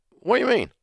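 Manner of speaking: put-on high, puzzled old granddad; grandfather's voice. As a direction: ask it like a baffled grandpa. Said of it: "And he said".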